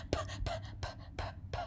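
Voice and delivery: P, p, p whispered as bare puffs of breath; whispered p sounds. Shown as a demonstration. Teacher showing students the p sound, whispered. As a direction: breathe out each p with no voice. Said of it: "That's a lot of P's".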